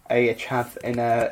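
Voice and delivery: ordinary talk, nothing marked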